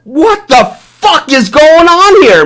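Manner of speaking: high, slow, drawn-out exclamation; re-enacted outrage. Vlogger quoting his earlier reaction to a video, re-enacted outrage. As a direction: imitate your own outburst, stretching the words in disbelief. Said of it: "I came out and I said".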